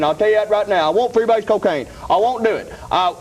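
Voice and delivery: ordinary delivery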